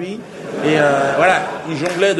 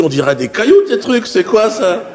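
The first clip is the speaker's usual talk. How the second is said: incredulous outburst; 5 semitones higher, 7 dB louder than usual. Retold or imitated. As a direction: scoff loudly at the stones. Teller mocks the pebbles. (le narrateur se moque des cailloux, fort et incrédule)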